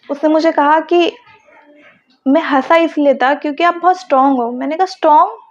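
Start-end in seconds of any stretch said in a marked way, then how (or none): 4.9-5.4 higher, rising retold "strong?"; puzzled query with a lifted ending; surprised echo question. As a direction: repeat the word back in surprise. Questions his surprising compliment.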